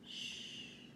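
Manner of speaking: a long gentle shh; soft shushing. Acted. Librarian hushing the children, soft shush. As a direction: hush the room softly.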